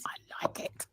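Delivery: barely-there whisper; whispered aside. Real, not acted. Enjoying the fun with co-host, whispered aside. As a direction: whisper it very softly to yourself.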